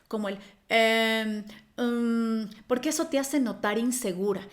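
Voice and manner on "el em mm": long drawn-out hesitation "em" and "mm"; demonstrated filler sounds. Shown as a demonstration. Coach showing fillers to avoid, drawn-out hesitant humming. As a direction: hum the filler slowly on a level tone. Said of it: "olvidarte de las muletillas".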